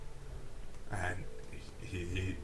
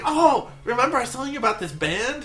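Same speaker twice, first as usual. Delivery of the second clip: high, loud and giddy; eager, pitched way up; childlike excitement; 12 semitones higher, 6 dB louder than usual. Acted. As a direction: bubble over like an excited child. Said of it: "He's like a child".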